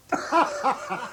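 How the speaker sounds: a big high burst of laughter; hearty laugh. Real, not acted. Hearing about artificial kneecaps, a hearty laugh. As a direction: laugh out loud at the absurd story.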